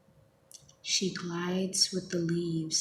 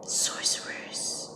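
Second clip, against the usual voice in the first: drawn-out cry kept to a whisper; whispered yell; slower than usual. A narrator's reading. Woman in the poem yells at her captors, in whisper. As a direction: stretch the word slowly, yelling under your breath. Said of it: "she yells".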